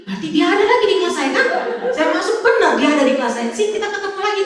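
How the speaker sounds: high-pitched, incredulous retelling; comic dismay. Retold or imitated. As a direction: relive the horror of meeting that pupil, playfully. Dismay at finding the pupil again.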